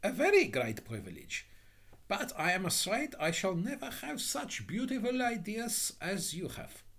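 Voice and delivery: high-pitched meek simpleton; humble, admiring Little Hans. Acted. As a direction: admire your better in a small high voice.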